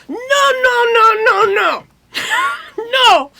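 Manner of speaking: high, loud run of shouted refusals; imitated loud objection. Producer walking in on the song, high shouted objection. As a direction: cut the music off with mock shouts.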